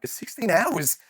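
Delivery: high, appalled squeak of disbelief; aghast disbelief. Retold or imitated. Kid asks his dad in horror about the cycling, aghast and high. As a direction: act like sixteen hours is unthinkable. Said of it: "I'm like".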